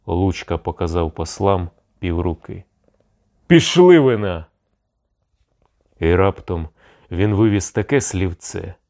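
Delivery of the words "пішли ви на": high, loud curse; angry dismissal. A narrator's reading. Cossack cursing the Moscow envoys away, angry dismissal. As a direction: send them packing with a loud oath.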